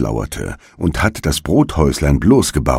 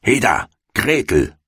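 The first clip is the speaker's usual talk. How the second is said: higher witch's hail across the yard; witch calling out; 6 semitones higher than usual. A narrator's reading. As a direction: call the girl over, impatient. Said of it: "rief sie dem Mädchen zu".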